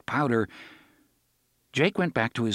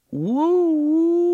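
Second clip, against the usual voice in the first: loud high woo-woo whistle; train whistle; 8 dB louder than usual. Acted. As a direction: hoot like a steam whistle.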